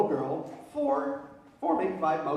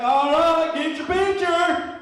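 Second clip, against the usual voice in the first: high, loud, drawn-out bossy; a brash tourist mom; 6 semitones higher, 5 dB louder, slower than usual. Retold or imitated. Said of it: "She's like".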